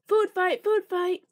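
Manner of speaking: rally the whole dining hall; mock yell. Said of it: "the Toad Twins yelled".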